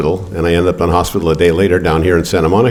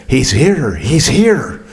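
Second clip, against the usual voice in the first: high, eager nurse announcing; excited nurse; 7 semitones higher, much slower than usual. Retold or imitated. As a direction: call it out like a nurse who has been waiting. Charge nurse announcing his arrival.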